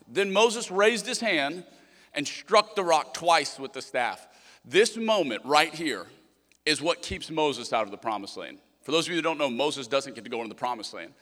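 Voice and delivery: ordinary talk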